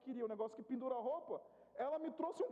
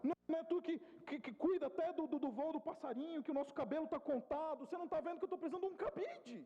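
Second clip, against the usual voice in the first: pitched-up frustrated pleading; frustrated prayer; 4 semitones higher than usual. Retold or imitated. Pastor frustrated in his car, pleading with God, pitched-up exasperation. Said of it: "fui pra casa frustrado".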